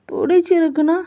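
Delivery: put-on puzzled childlike. Jungkook confused.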